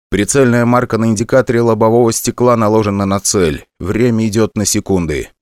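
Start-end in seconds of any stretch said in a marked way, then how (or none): none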